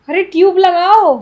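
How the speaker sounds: loud, slow demand for the ointment; demanding shout. Acted. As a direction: bark the order at the doctor. Patient demands ointment loudly.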